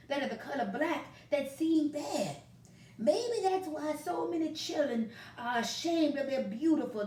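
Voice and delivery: put-on a gentle old southern grandpa explaining; kindly grandpa. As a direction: grandfather comforting a sad grandson, folksy. Grandpa voiced in story.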